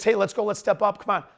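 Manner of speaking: ordinary talk, nothing marked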